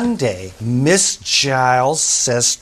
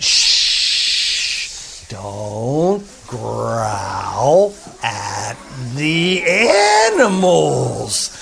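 very slow, hushed scold; gentle scolding; 4 semitones lower, much slower than usual. Acted. Teacher gently scolding her class, slow and hushed. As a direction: hush them gently, drawing it out.

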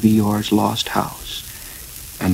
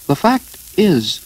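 hesitant explaining; 9 semitones higher, 3 dB louder, slower than usual. A narrator's reading. Pooh struggles to explain himself.

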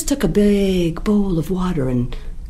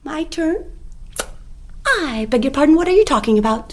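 higher, innocent rabbit voice; rabbit's feigned innocence; 10 semitones higher, faster than usual. Acted. Rabbit pretends not to know to the jackal. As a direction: play dumb, all surprise.

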